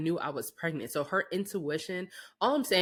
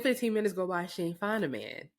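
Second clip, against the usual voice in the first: normal talking voice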